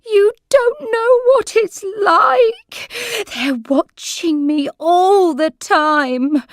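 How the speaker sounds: high, loud, slow sobbing words; crying. A narrator's reading. Old lady in tears telling carer she is watched, crying. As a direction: weep it out, frightened and lost. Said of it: "she burst into tears".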